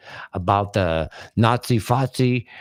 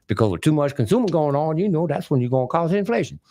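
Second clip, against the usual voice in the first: higher-pitched mock Southern senator; Carolina senator; 5 semitones higher, faster than usual. Senator warning about inflation, imitated Carolina politician's voice. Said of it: "Carolina, uh, senator way back".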